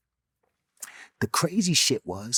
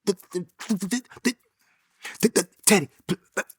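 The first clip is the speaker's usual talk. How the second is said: a light imitation of a stutterer; lightly mimicked stutter, the, the, pl-, pl-; 6 semitones higher, faster than usual. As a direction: stumble on the first sounds, repeating them. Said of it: "he's stuttering".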